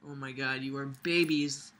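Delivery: ordinary delivery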